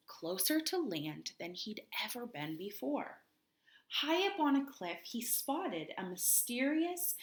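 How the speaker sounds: mysterious storybook read-aloud; slowed-down read-aloud sliding into a whisper; story narration. A narrator's reading. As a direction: build the mystery slowly for children. Teacher reads story aloud.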